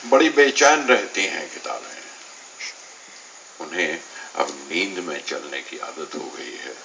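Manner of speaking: measured verse in separated phrases; poetry recitation. Acted. Poet recites a poem on neglected books to listeners, poetry recitation. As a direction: lift each opening line, let the next fall away.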